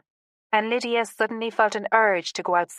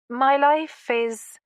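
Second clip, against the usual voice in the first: higher, drawn-out "My life is," left hanging; slow down and stop unfinished on "is"; hesitant, suspended "My life is,"; breaking off; 6 semitones higher, 4 dB louder, much slower than usual. A narrator's reading. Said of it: "she paused".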